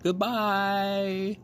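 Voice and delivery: high, drawn-out goodbye call; one last long call after the ship. A narrator's reading. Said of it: "she called".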